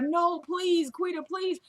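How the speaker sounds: high-pitched imitated begging; desperate pleading. Condemned woman begging her boss, mock-desperate.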